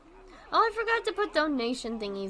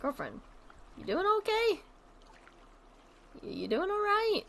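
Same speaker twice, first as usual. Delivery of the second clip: cooing to animal; 9 semitones higher than usual. Real, not acted. Streamer cooing high at her gorilla in the zoo game. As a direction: gently coax a shy gorilla.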